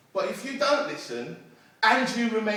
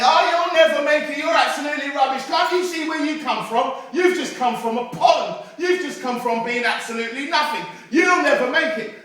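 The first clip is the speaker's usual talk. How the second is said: high, loud scornful taunting; jeering crowd; 7 semitones higher, 9 dB louder than usual. Acted. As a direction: tear him down, really loud. Crowd jeering at the third frog.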